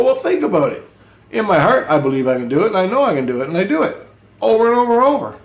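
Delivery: high, loud, insistent retort; retold heated argument. Arguing back at J C about belief, heated insistence. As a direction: argue it hard, pitch soaring on the repeats.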